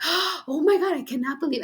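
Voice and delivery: pitched high, mock disbelief; retold astonished cry. Retelling the moment she recognized her dad's song, astonished. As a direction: gasp it out like it just hit you.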